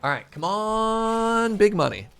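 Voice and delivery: high, slow, stretched pleading; drawn-out hopeful plea. Real, not acted. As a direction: beg the game for luck, stretching words. Pleading with slot-like loot.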